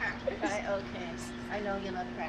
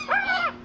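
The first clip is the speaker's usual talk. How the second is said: mock scream; 9 dB louder than usual. Pretend fright for the bird.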